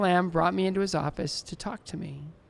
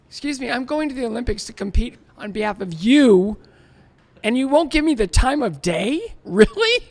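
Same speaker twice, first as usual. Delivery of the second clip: louder, higher mock outrage at a rude stranger; mock indignation; 5 semitones higher, 9 dB louder than usual. Skater retelling his outrage at the passerby, mock indignant and loud. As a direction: play up the indignation, half laughing.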